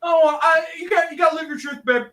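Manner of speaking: high, fast, loud diva shout. Acted. Cher shouting to banish the ghost. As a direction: blast him away.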